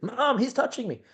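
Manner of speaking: put-on high squeaky tattling kid voice; whiny tattling little sibling. Shown as a demonstration. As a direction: exaggerate it like a whiny little brother. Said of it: "Exaggerate it".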